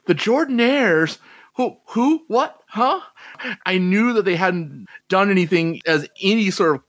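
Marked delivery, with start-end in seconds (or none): 0.1-3.0 retold confusion, rising higher in short questions; baffled reaction. Retelling seeing the list of names, mock bafflement. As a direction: re-enact doing a puzzled double take. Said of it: "I saw the list of names, and it's like".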